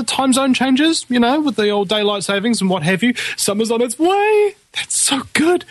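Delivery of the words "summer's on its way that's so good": excited. Real, not acted. Gleeful about summer coming.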